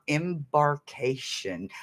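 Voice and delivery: slow, careful "embarkation"; said slowly. Real, not acted. Streamer trying out a newly learned word, slow careful "embarkation". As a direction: draw out "embarkation" syllable by syllable. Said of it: "I have to say it slowly".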